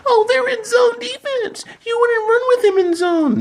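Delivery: very high, squeaky mock voice with a laugh; mocking commenter. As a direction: parody the dumb commenter in a shrill falsetto. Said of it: "we have idiots in the comments".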